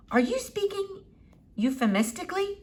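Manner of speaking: a hint of an old eccentric doctor; Doctor Measham. A narrator's reading. Old doctor peering at the squirrel, lightly foreign.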